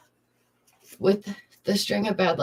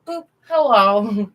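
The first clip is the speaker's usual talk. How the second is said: bright intro greeting; 2 semitones higher, 8 dB louder, slower than usual. Shown as a demonstration. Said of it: "That's what I should do every time".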